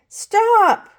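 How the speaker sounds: high, loud cry of stop; boy stopping a lunch fight; cried out. A narrator's reading. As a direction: shout stop to break it up. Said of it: "cried Pablo".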